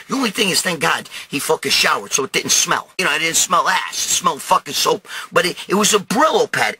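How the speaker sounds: ordinary talk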